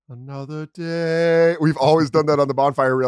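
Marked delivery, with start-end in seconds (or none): 0.1-1.6 very slow, solemn mock movie line; mock dramatic line. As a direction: stretch it out like a melodramatic hero.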